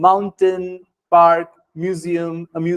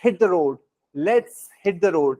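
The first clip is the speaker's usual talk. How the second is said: slow model phrase; much slower than usual. Shown as a demonstration. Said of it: "तो आपने कहा".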